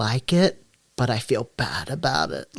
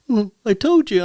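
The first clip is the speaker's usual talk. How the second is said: mock knowing hint; 10 semitones higher, 5 dB louder than usual. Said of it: "people have been like".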